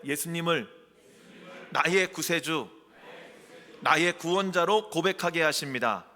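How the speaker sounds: loud, projected phrases with pauses between; leading a repeat-after-me. Shown as a demonstration. Preacher asking the congregation to repeat the confession, loud call-out. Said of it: "한번 따라해 보실래요?".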